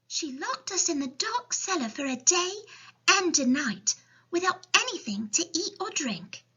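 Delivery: put-on high little girl voice; child's storybook voice; Sophie. A narrator's reading. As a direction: explain as a young orphan, high-pitched. Sophie tells of punishment.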